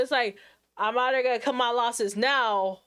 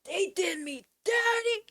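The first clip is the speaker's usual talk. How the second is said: dramatic whine; 8 semitones higher, slower than usual. Retold or imitated. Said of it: "I would be like".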